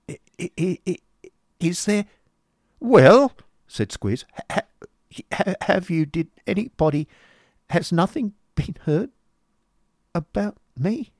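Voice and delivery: high, halting, timid stammering; a frightened boy barely daring to ask; nervous stammer. A narrator's reading. Boy Smike asking the master, timid stammer. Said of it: "replied the youth".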